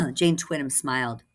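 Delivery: ordinary delivery, nothing marked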